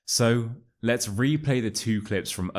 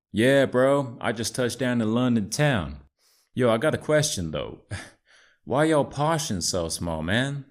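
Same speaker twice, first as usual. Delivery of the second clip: put-on American accent; 3 semitones higher than usual. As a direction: play Chad, an American bro. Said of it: "it's Chad".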